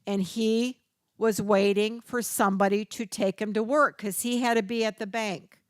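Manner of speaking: ordinary talk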